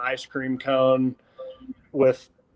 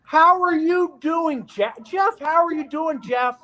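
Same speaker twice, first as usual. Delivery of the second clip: much higher, slow mock voice; imitating the coach; 13 semitones higher, slower than usual. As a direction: ham up the coach greeting, high and drawn out. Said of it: "and he's like".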